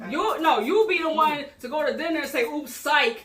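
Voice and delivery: imitated heated complaint; retold telling-off. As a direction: act out her rant at him. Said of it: "she goes off on him".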